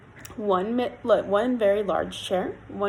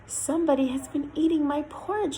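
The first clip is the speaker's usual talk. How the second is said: higher, lightly voiced mother bear; a hint of a quiet mother, pitched up; 6 semitones higher than usual. A narrator's reading. Mother Bear finds her porridge tasted, mildly quiet. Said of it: "in a quiet voice".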